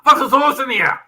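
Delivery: loud, high mock German shout; hammed-up German outburst; mock German soldier. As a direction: yelp it like a baffled wartime German. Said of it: "the Germans tried to tune in their radios".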